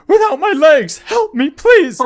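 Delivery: extremely high, loud mock whimper; hammed-up helpless old gent. Jasper pulls guard down, very high fake plea. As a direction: overplay fear to distract the guard. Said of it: "and he says".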